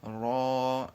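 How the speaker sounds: را said full and thick, slow and stretched; full heavy letter. Shown as a demonstration. Teacher showing students a heavy را, slow and full. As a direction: give را a heavy, full-mouthed r. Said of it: "پر ہی پڑھنا ہے".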